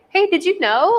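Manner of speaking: bright curious question, pitched well up; playfully curious, voice lifted high. Retold or imitated. Love gently asks, curious and high. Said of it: "Love is like".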